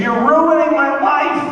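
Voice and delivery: put-on high, loud bratty teen shriek; screaming teen. Comic sketches teen screaming at proud mother, high shriek. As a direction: shriek it like a spoiled sixteen-year-old. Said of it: "she's screaming at her mother".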